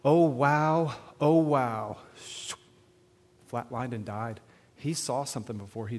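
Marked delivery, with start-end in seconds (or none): 0.0-2.0 drawn-out loud, awed exclamation; hushless, full-voiced retold amazement; retold dying man's wonder. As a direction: imitate someone awestruck by a sight, each repeat trailing longer. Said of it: "He went".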